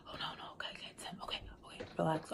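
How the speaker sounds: a nervous whisper psyching herself up before answering; panicked whisper. Retold or imitated. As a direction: whisper to calm yourself down as the phone rings. Nervous self-talk as he calls.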